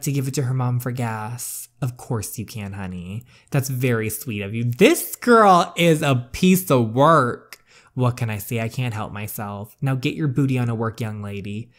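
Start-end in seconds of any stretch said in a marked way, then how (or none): 4.6-7.6 slow, louder, drawn-out disbelief; exasperated comment. Real, not acted. Player reacting to the mean sister, slow exasperated comment. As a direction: drag it out, fed up.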